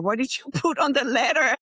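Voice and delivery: asks through a real laugh, pitch up; laughing question. Real, not acted. Host laughing as she asks the guest what she wrote, laughing. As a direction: let the laugh break into the question.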